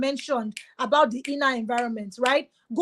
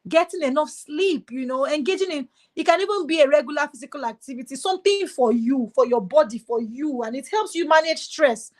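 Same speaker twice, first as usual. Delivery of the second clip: ordinary talk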